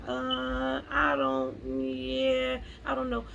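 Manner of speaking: mock-hesitant, drawn-out hemming and hawing; timid hesitation. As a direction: act out being unsure, stalling on each phrase. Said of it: "don't be scared or timid".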